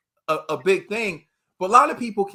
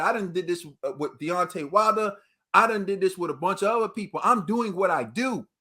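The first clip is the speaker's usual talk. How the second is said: ordinary talk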